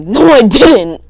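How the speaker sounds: slow, loud firm denial. Retold or imitated. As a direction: shut the accusation down. Kid denies prank to teacher.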